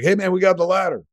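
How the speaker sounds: breezy firefighter. Retold or imitated. Firefighters shouting up about their ladder, quick and high. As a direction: toss it off like a cheerful firefighter.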